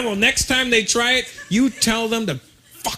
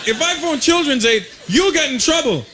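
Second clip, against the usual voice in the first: high, loud panicked pleading; panicked kid; 8 semitones higher, 3 dB louder than usual. Retold or imitated. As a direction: voice jumps up in alarm. Kid panics at dad's call.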